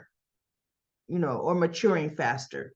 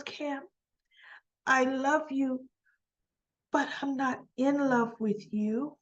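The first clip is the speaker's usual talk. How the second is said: slow, higher, soft imitation; retold gentle letdown; 5 semitones higher, 4 dB quieter, slower than usual. Recapper voicing Joss turning Cam down, tender. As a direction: let them down gently, slowly.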